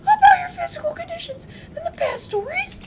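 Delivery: very high put-on squeal; hammed-up frantic high plea; pleading attendant. Phil holding the lord back, in a high, hammed-up tearful shriek.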